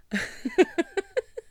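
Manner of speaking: high-pitched laugh. Real, not acted. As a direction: burst into a high giggle. Laughing at bad French.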